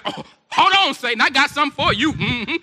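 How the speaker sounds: high, loud mock indignation; sassy comeback. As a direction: play someone squaring up to a rival. Acting out retort to tempting Satan.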